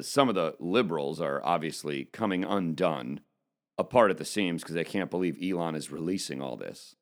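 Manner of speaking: ordinary talk, nothing marked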